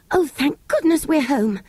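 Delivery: lightly voiced relieved girl. A narrator's reading. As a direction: sigh of relief on coming home. Jane's relieved cry.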